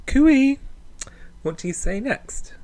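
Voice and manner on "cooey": much higher and louder sing-song call; high, loud camp greeting; camp cooey. Shown as a demonstration. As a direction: trill it out like hailing a friend across the street. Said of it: "or even".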